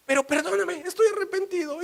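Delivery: very high, hammed-up tearful begging; mock wailing. Caught husband begging his wife, mock wailing. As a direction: wail for forgiveness in a high put-on cry. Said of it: "se pone a chillar".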